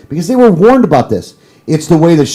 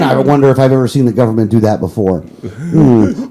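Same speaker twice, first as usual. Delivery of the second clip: ordinary delivery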